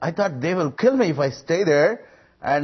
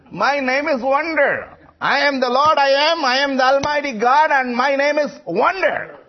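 ringing proclamation; 9 semitones higher, 3 dB louder than usual. Acted. Preacher proclaiming God's name to the congregation, high and loud. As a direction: preach it out to the hall.

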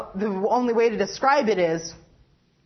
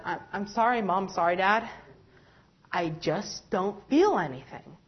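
sad apology; 5 semitones lower, 6 dB quieter than usual. Retold or imitated. Apologizing to parents, feeling empty.

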